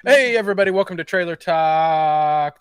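loud, slow announcer welcome; show host intro. Acted. Host greeting viewers at the top of the show, big and loud. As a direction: boom out the show's opening.

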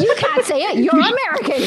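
high, loud, giggly teasing; laughing tease. Real, not acted. Host teasing the American guest, high laughing tease. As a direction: tease her through laughter.

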